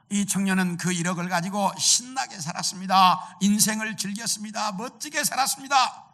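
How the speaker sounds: rising, exuberant storytelling; excited. A narrator's reading. Narrates the youth's high life. (신나서 점점 높아지는 목소리로)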